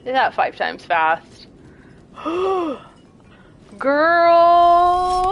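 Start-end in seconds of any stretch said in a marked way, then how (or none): 2.1-5.3 surprised exclamation. Real, not acted. Exclaims at the game.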